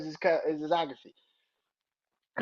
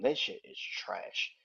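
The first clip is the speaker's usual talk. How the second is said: disgusted; 6 semitones lower, 5 dB quieter, much slower than usual. Real, not acted. Dismissing the song.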